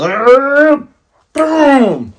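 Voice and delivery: high, loud rising roar, then boom; growing roar bursting into a blast; imitated launch noise. Imitates Luffy firing it back.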